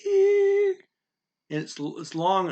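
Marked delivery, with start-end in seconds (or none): 0.1-0.7 ear held way up high and steady; high level tone. Shown as a demonstration. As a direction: sing ear on one high, flat pitch. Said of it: "just stays high".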